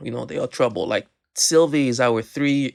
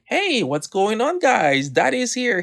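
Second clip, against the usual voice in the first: put-on bright and much higher, calling out to the kids; cheerful dad entrance; 9 semitones higher than usual. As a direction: breeze in like a happy papa. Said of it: "I walk in with a mood of like".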